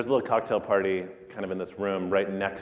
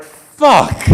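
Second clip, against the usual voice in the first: sudden curse, much higher and louder; shouted curse; 15 dB louder than usual. Retold or imitated. Chef hears his restaurant called last and curses out loud. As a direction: blurt it out like a gut punch.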